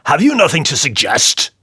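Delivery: booming, mocking tyrant; drunken king crowing over his joke; loud jeering king. A narrator's reading. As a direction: taunt the dwarf loudly. King voiced in story.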